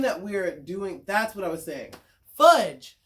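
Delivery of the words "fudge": sudden loud exclamation; frustrated outburst. Real, not acted. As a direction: blurt it out loudly in frustration. Catches herself losing her thread.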